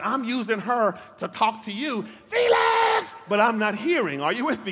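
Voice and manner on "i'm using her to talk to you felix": building to a high loud shout of the name; God calling out. Acted. God answering the preacher's blame, rising to a shout. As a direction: let God's reply climb into a shout. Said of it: "And he's like".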